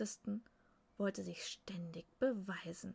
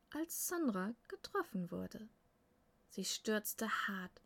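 plain reading voice

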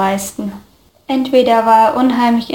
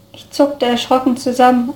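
ordinary delivery, nothing marked

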